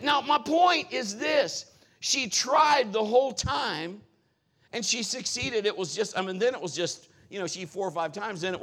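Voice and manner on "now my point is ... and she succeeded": slow, louder, pointed delivery; emphatic preaching. Real, not acted. Pastor making his point to the congregation, slow and emphatic. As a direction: press the point home slowly.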